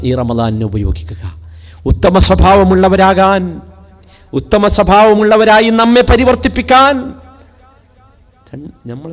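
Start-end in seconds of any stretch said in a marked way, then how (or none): 1.9-6.9 preaching at full force. Acted. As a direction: proclaim the verse to the hall. (ഉച്ചത്തിൽ പ്രസംഗം)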